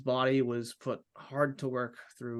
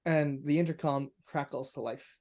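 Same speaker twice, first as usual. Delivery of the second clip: normal talking voice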